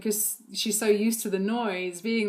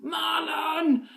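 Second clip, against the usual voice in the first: high calling shout; calling Marlon; 7 semitones higher than usual. Retold or imitated. Toddler calling the maintenance guy, high shout. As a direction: call out for the handyman like a toddler. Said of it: "she's like".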